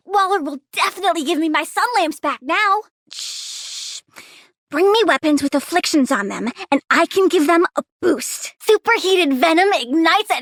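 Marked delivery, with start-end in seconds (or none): 3.1-4.0 unvoiced shushing; soft hiss for silence; hush. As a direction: shush them, barely a breath. Hushing the player.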